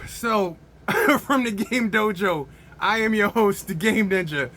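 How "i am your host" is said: normal talking voice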